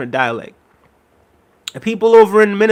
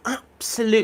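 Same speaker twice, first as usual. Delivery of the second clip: British commentator; 6 semitones higher, 5 dB quieter than usual. Retold or imitated. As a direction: mimic a British football pundit stretching "Absolutely". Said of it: "They put such emphasis on the absolutely".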